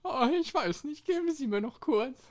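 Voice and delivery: normal talking voice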